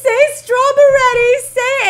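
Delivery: high, loud mock wail; tearful pleading. Mother retelling her plea to her daughter, mock-tearful pleading. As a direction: beg in a hammed-up crying whine, drawing it out. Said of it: "I cried. Like, I was like".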